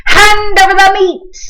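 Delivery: high, loud bark of a pirate; greedy captain shouting for meat; pirate demand. A narrator's reading. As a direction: demand the food rudely. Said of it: "gulped his food and said".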